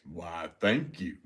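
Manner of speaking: slow, playful put-on thank-you. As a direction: ham up a grand voice, slowly. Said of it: "I have a great voice".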